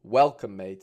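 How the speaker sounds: ordinary talk, nothing marked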